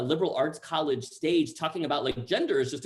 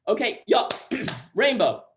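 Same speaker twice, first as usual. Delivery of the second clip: loud, raised interrupting call; imitated director calling out, throat-clear midway; calling to order; 7 semitones higher, 8 dB louder than usual. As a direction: cut in to get a child's attention.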